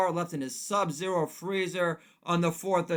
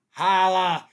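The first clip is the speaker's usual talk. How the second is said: loud holla; 3 semitones higher, 10 dB louder than usual. Acted. Catchphrase shout.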